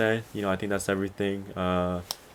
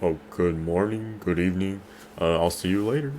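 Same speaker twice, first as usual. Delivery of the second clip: radio voice. Retold or imitated. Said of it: "I have my radio voice on".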